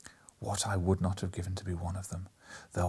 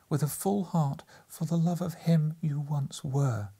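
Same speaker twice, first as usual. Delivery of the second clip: higher, sorrowful voice; sorrowful young woman; 6 semitones higher than usual. A narrator's reading. Girl recalls their old betrothal to him, higher and sorrowful. Girl releases her lover.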